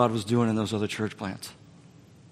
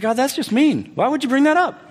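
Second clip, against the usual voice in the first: whiny complaint; 13 semitones higher, 4 dB louder than usual. Retold or imitated. Whining at God.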